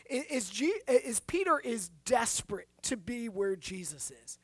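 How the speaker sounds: ordinary delivery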